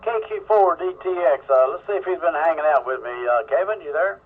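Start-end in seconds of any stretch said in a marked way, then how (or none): none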